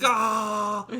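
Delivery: short loud high yelp of pain; hammed-up pain yelp. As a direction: cry out under torture. Wesley pain cry.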